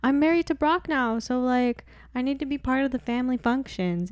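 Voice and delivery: high, pushy new-wife voice; Barbara Jean impression. New wife insisting to the ex-wife, high-pitched imitation. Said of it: "She's like".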